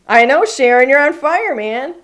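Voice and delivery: loud, high praise for a winner; excited cheer. Real, not acted. Host cheering a viewer who keeps winning, excited. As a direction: cheer her on.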